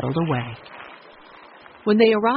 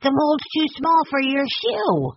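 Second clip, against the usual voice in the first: put-on very high child voice, drawn out; high, slow insistence; insistent; 11 semitones higher, slower than usual. A narrator's reading. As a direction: prove the point as a stubborn little boy. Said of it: "Benny insisted".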